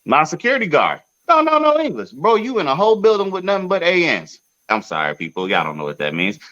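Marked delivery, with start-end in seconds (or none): 0.0-4.4 incredulous rant. Real, not acted. Ranting to friends about the security guard, incredulous and high.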